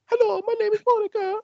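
lightly put-on Polish accent, high at the end; a hint of Polish accent introducing herself; mock Polish accent. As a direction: play the wife slipping into a fake Polish accent. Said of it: "I reckon she's like".

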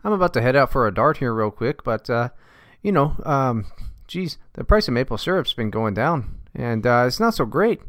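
a folksy Canuck chatting, bud; mild mock Canadian accent on request. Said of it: "How's that?".

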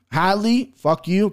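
usual speaking voice

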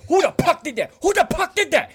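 high, loud enraged yell; screaming imitation. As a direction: imitate an instructor losing it. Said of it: "screaming, losing his shit".

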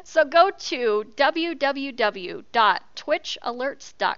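slow, spelled-out address; slow and deliberate. Real, not acted. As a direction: spell it out slowly. Spelling out the URL.

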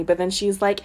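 normal talking voice

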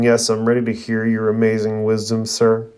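usual speaking voice

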